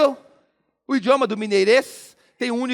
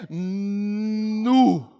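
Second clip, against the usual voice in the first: long hummed "nnnu" of amazement; awestruck Mineiro "nu". Acted. Mineiro facing a gigantic cheese, long awestruck "nu". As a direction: hold "nu" out in trembling-at-the-sight awe. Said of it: "começou a tremer ... falou o quê?".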